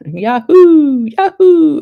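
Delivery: high, loud whooped "Yahoo"; exclaimed interjection. Shown as a demonstration. Host demonstrating Yahoo as an interjection, high loud whoop. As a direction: whoop it out like a cry of triumph. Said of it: "as an interjection".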